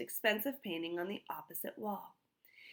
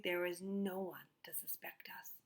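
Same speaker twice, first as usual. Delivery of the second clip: smug hushed whisper; thief whispering; 6 dB quieter than usual. Acted. Thief answering in the dark.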